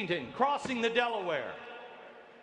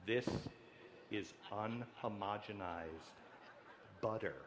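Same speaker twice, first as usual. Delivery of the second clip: hushed; 10 semitones lower, 11 dB quieter than usual. Pretend. Reveals contraband butter.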